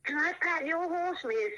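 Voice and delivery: lightly played child asking a favour; little girl. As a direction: ask sweetly like a small child. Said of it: "The little girl said to her".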